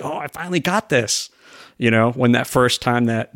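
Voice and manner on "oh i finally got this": higher-pitched, lightly acted triumph; a touch of thrilled relief; retold delight. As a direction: imitate a pleased breakthrough, pitch lifted. Retold triumph at getting a move.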